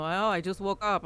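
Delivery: put-on groggy Russian accent; sleepy, just-woke-up Russian accent. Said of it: "in Russian".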